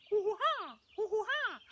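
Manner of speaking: high, silly hoots; silly sound effect. Shown as a demonstration. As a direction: hoot like a goofy sound effect. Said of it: "random sound effects of randomness".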